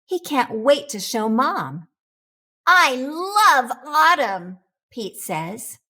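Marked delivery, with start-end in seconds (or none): 2.7-4.6 higher, louder and slow, savoring it; a boy gushing to Mom about autumn, delighted. Acted.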